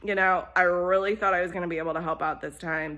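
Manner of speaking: fast, eager gossiping excuse; rushed. Acted. Goose rushes her excuse to the hen, fast. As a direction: hurry through the excuse.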